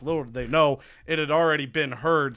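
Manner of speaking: normal talking voice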